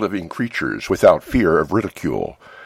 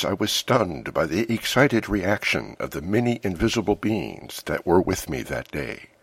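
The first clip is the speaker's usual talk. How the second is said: usual speaking voice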